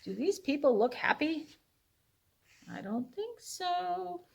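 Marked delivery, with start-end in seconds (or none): none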